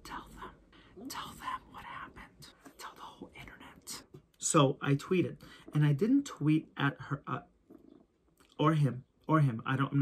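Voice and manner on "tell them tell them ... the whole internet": unvoiced inner-voice whisper. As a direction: hiss it like a little devil on the shoulder. Inner voice urging him on.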